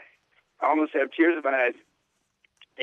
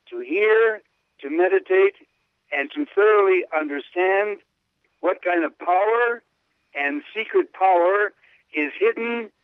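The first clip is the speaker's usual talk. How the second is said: raised, measured reading of a sacred text; reverent reading; 4 semitones higher than usual. Acted. Host reads the Pope's words to his listener, reverently. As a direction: read it out like a devotional passage.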